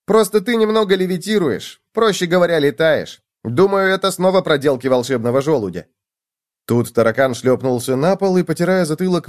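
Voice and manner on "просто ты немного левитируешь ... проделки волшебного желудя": high-pitched explaining voice; amused gopher. A narrator's reading. Gopher explains levitation to cockroach, amused. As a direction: explain lightly, lifted in pitch.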